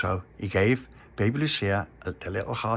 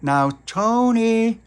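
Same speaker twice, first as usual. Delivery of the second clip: slow loud call, much higher; shouted; 14 semitones higher, 8 dB louder, much slower than usual. Acted. Little Pappy calling Tony through the letterbox, slow loud shout. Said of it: "shouted through the letterbox".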